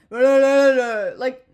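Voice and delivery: loud, annoyed mumbled da da da; mock angry caller. Caller snapping gibberish back over a bad phone line, loud. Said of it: "and I'm like".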